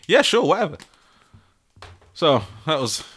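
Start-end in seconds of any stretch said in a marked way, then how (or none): 0.1-0.8 higher, dismissive yeah sure whatever. Shown as a demonstration. Podcaster acting out the acronym, dismissive. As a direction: brush it off teasingly. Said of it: "Y S W".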